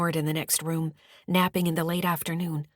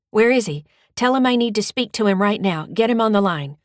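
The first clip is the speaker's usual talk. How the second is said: demanding shout; 4 semitones higher, 8 dB louder than usual. Acted. Demanding caller on the phone.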